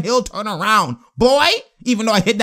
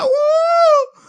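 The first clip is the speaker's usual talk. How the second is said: high shouted woo; imitated woo; 4 dB louder than usual. Imitating Gasol celebrating, shouted woo. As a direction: let out a big shout of woo. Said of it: "He's like".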